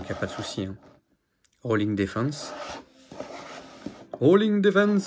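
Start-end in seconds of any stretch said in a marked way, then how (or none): 4.1-5.1 high, hammed-up cartoon hero calling the attack; anime attack call. Collector acting out Shun's attack for viewers, high anime hero call. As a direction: belt it out like Shun launching his move. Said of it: "Je le fais bien quand même".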